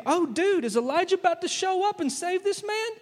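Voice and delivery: high, excited mock amazement; hammed-up, quick wide-eyed wonder; astonished bystander. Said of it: "so they're thinking".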